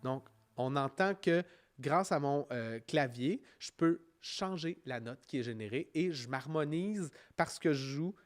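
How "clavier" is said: usual speaking voice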